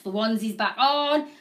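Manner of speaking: normal talking voice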